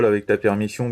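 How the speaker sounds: ordinary delivery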